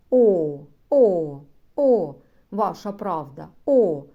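гучно, в захваті, вигукує «О» на всю силу; радіє, як дитина, виспівуючи «і, о»; захоплені вигуки голосних. Acted. (у захваті вигукує голосні)

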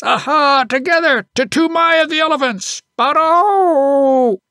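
building from loud high calls to a full ecstatic yell; wild yell. A narrator's reading. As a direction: cry out each elephant's name, wilder and wilder. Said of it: "that last wild yell".

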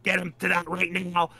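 normal talking voice

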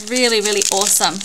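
ordinary talk, nothing marked

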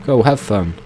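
ordinary talk

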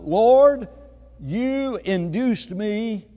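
preacher voicing a bold complaint; bold address to God. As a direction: address God boldly, drawing out the words. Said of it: "listen to the boldness".

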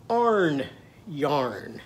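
"Arn yarn" spaced, each syllable drawn out; vowels swapped to "ar". Shown as a demonstration. Teacher leads children repeating, drawn-out "Arn yarn". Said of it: "Can you say that?".

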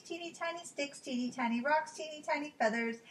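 usual speaking voice

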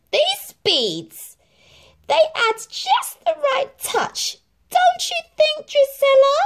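very high, loud, sneaky; sneaky stepmother. A narrator's reading. Stepmother slyly points out the beads to Drizella. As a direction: plant the suspicion slyly. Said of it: "she asked sneakily".

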